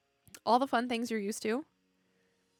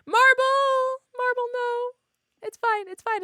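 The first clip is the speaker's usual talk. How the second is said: high, loud startled cry of a name; surprised shout; 13 semitones higher, 9 dB louder, slower than usual. Real, not acted. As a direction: yelp out the name in dismay. Streamer shocked by chatter Marble.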